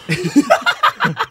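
high, sudden cackle; laughing burst. Real, not acted. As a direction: crack up helplessly. Cracks up at a joke.

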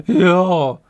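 loud, high cry of relief; relieved. A narrator's reading. Ang as the attack ends, loudly relieved. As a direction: let it out in one loud cheer. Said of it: "ulevil si Ang".

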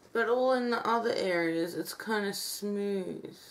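stiff lips barely moving; talking with cracking mask; through a dried face mask. Real, not acted. Seen 2: Cracked dried clay mask covers face around lips throughout; references bare-faced.

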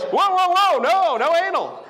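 alarmed objection. Retold or imitated. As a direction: stop him quickly. She objects in retold dialogue.

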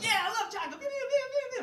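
put-on high, loud, eager child voice; excited little kid. Second grader offered chocolate by the bully, high and excited. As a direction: squeal it out like an overeager kid.

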